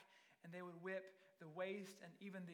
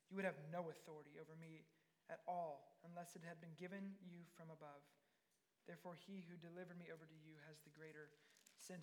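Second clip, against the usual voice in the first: low-key, softer, measured reply; quiet calm answer; 3 semitones lower, 7 dB quieter than usual. Acted. Jesus answering Pilate's threat, quiet and calm. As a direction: answer softly, with calm authority.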